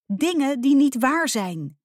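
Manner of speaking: high, loud, drawn-out protest; upset yell. A narrator's reading. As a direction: protest loudly, stretching the words. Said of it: "brulde ze". (luid, hoog en gerekt protest)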